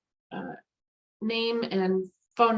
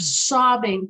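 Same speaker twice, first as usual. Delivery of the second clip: usual speaking voice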